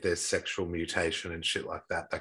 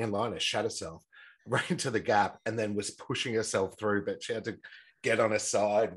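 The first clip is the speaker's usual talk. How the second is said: ordinary talk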